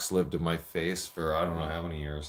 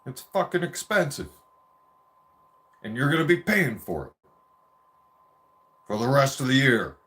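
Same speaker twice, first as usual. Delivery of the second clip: angry dad; 4 semitones higher, 5 dB louder than usual. Retold or imitated. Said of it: "He's like".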